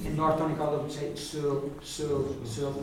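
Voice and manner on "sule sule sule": Sule with an oo on unrounded lips. Shown as a demonstration. Teacher showing North Donegal speech, unrounded U. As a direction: say Sule with the oo unpursed. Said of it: "an unrounded U".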